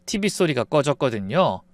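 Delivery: usual speaking voice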